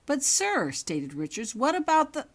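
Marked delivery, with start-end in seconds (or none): 0.0-0.7 urgent protest. A narrator's reading. Prefect protests. 1.5-2.3 urgent protest. A narrator's reading. Prefect interrupted.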